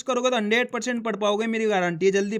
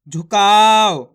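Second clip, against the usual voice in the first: झुकाव said whole, slow and louder, falling; blended whole word; 10 dB louder, much slower than usual. Shown as a demonstration. Teacher shows the final blended word झुकाव. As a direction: announce झुकाव firmly and slowly. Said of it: "देखो किस तरीके से है".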